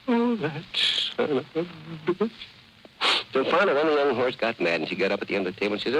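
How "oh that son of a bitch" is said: mock crying. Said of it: "Kept saying to herself".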